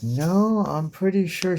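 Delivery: high mock protest. Voicing a character's protest.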